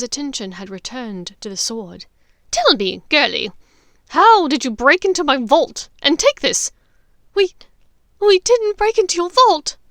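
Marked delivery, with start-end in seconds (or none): 2.5-6.7 loud, menacing interrogator voice, rising; menacing Bellatrix. Acted. Bellatrix threatening Hermione, loud and menacing. 7.3-9.8 put-on high, loud, terrified young voice; frightened denial. Hermione denying to Bellatrix, high and terrified.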